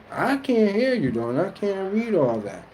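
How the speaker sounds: high, mock whiny protest; retold complaint. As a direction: act out not being heard. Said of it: "I'll be like".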